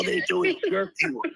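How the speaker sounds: raspy, croaky voice; hoarse, strained rasp; Penguin-like voice. Real, not acted. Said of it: "sound like the fucking Penguin".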